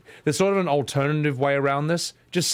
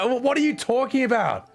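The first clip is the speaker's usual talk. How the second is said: higher, baffled and indignant; higher, fed-up bewilderment at football talk; exasperated self; 10 semitones higher than usual. Retold or imitated. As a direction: play the confused outsider getting annoyed. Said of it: "It's like".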